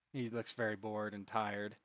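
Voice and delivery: normal talking voice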